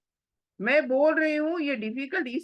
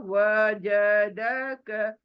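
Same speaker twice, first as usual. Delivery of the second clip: वजदक broken into letters, very slow; spell it out slowly; much slower than usual. Shown as a demonstration. Teacher spells वजदक out slowly for students first. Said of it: "पहला spelling करो".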